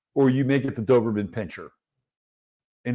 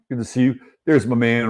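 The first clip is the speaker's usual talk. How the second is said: normal talking voice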